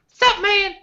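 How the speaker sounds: high, piping little tough guy voice; mock high-pitched goon introducing himself; high squeaky voice. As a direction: squeak it out high like a tiny gangster. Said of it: "I've got the super high voice".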